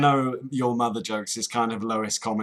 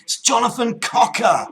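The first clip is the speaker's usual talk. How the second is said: loud, much higher Cockney correction; exasperated shout; 6 semitones higher, 6 dB louder than usual. Pretend. Cockney caller correcting the agent, exasperated shouting. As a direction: insist on the right surname at full tilt. Agent says wrong surname.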